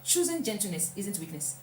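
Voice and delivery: ordinary talk, nothing marked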